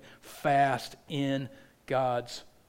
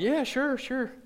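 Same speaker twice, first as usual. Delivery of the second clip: quoting Tyler, high; 10 semitones higher, slower than usual. Retold or imitated. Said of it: "He's like".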